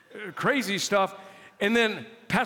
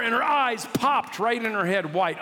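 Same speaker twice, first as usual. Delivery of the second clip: ordinary delivery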